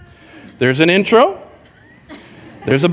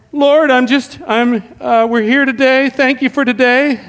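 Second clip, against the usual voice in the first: higher, halting, unsure prayer; nervous first prayer; 6 semitones higher than usual. Retold or imitated. New guy's first prayer, halting and pitched up. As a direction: stumble through it, pitched up. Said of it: "they're like".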